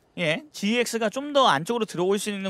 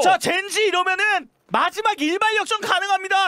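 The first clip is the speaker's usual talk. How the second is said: excited shout; 8 semitones higher than usual. Real, not acted. Excited play-by-play call.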